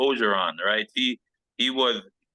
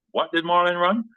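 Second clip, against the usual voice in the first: higher-pitched coach's question, rising to the end; coach calling out; 8 semitones higher, 4 dB louder than usual. Retold or imitated. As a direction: call it out like a head coach wanting a result. Head coach calls out a question.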